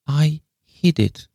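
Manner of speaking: high, slow little pipe; piping. A narrator's reading. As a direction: pipe it up slowly, like an old lady. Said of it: "piped Miss Le Fane".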